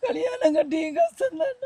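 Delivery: high, breaking sob on the words; real crying that pushes the voice up. Real, not acted. Mother speaking of her marriage, crying. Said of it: "அழாதீங்கம்மா".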